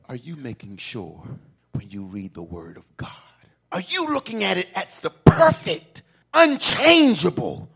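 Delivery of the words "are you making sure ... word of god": sudden soft question. Acted. Sudden drop after shout.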